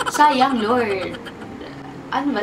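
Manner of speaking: ordinary talk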